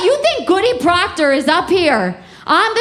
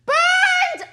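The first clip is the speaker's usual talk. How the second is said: high, slow shout of burned alive; shouted punchline; much slower than usual. Acted. Comic to audience, shouting the punchline. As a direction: yell it out as the big reveal.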